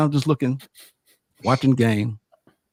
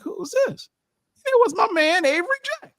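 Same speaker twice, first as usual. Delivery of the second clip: 1 surprised. Retold or imitated. Surprised by a familiar voice.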